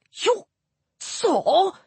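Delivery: high, halting disbelief; aghast, high-pitched echo; astonished. A narrator's reading. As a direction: break it with a stunned pause. Stunned god almost drops scroll.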